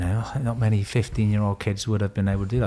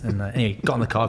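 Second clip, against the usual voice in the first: ordinary talk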